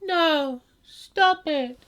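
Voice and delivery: high, limp, unconvincing protest; flat high no. Retold or imitated. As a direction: whine it out with no fear. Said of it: "She goes".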